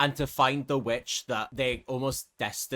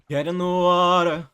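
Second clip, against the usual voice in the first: loud, eerie command to get in; a lure with a threat in it; menacing siren; 3 semitones higher, 6 dB louder than usual. Acted. Bianca luring Argus into the water, eerie loud command. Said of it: "That's kind of what she's like".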